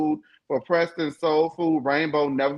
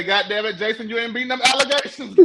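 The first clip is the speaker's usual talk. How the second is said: higher, half-laughing jab; laughing tease; 7 semitones higher than usual. Retold or imitated. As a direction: tease him through a laugh. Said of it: "And I say".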